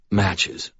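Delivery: plain reading voice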